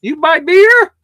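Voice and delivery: high, loud mock Indian shopkeeper asking "You buy beer?"; loud mock Indian-accented shopkeeper question. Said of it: "Go back to India".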